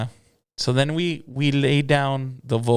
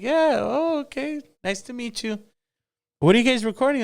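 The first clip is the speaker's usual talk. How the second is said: much higher, bright lady voice for the boss; Boss Lady's voice; 12 semitones higher than usual. Retold or imitated. Boss Lady greeting Fred and the new singer, in a much higher lady voice. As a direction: play the gracious lady boss greeting the room.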